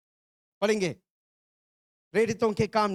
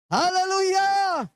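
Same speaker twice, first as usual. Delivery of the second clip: long, high, loud praise shout; ringing preacher's cry to the congregation; exultant shout; 11 semitones higher, 5 dB louder, slower than usual. Real, not acted. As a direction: shout it out high, stretching the word.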